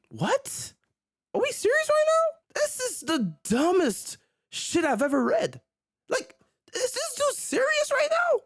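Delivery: squeaky high, stunned outrage; can't believe what you just read; outraged disbelief. Real, not acted. Reacting to a quote in disbelief.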